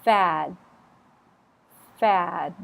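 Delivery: Fad ending in a crisp d stop; clear final D stop. Shown as a demonstration. Teacher modelling fad, definite final stop. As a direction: close fad with a clean d. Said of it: "I need to hear a definite stop".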